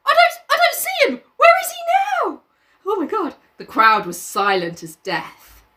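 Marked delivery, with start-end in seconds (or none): 0.1-3.4 put-on much higher alarmed boy; high frightened schoolboy; frightened Piggy. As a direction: panic as you search for the child. Piggy voice held.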